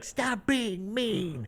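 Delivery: very slow, high, soft mock whine; drawn-out plea imitating a comedian; Natalie Cuomo. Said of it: "where she's just like".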